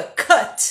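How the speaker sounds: "cut" with the U said as an open A; "cut" like "cat" but with an open A; teacher's correct version "cut" with an A vowel; open A sound. Shown as a demonstration. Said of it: "U com som de A".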